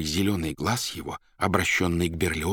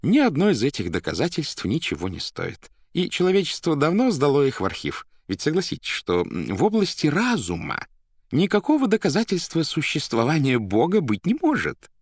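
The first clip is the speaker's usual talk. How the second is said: high-pitched, louder voice of a learned editor; Берлиоз; 9 semitones higher, 4 dB louder than usual. A narrator's reading. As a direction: argue it high and insistent. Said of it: "с сожалением ответил Берлиоз". (высокий голос образованного редактора)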